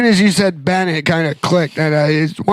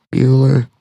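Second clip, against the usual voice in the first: Ben Stein monotone; 3 semitones lower than usual. Retold or imitated. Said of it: "that one guy".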